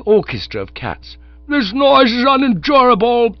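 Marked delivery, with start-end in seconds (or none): 1.5-3.4 higher, loud exasperated yell over the noise. Acted. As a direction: yell to be heard over howling cats. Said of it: "yelled Sir Roderick".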